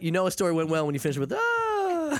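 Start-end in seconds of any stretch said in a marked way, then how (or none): 1.3-2.2 high, drawn-out contented ah; satisfied ah. Pretend. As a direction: sigh it out like a story just landed. Satisfied sigh ending a story.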